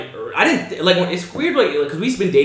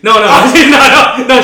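slow, louder, laughing no; laughing denial; 7 semitones higher, 10 dB louder, slower than usual. Real, not acted. Correcting himself to his friend, laughing. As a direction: laugh off the slip.

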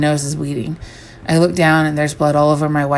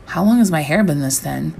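ordinary delivery

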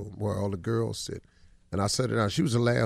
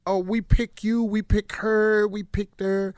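mocking judges; 8 semitones higher, 3 dB louder, slower than usual. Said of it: "This was just".

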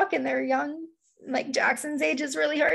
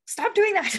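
sharp, high, loud snap; snapping at kid; 8 semitones higher, 4 dB louder than usual. Retold or imitated. Parent loses patience with her kid, sharp loud snap. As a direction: snap it out at a misbehaving child. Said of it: "I just more snap more easily".

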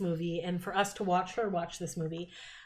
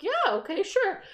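much higher, hesitant agreeing; high mock Nick voice; 11 semitones higher, slower than usual. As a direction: play the awkward star saying yes. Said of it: "he's like".

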